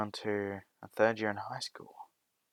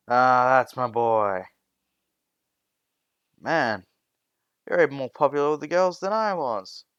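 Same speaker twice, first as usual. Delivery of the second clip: proud teasing dad; 3 semitones higher, 7 dB louder than usual. A narrator's reading. Dad to son home from a date, proud teasing. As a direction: tease your son with loud, mild pride.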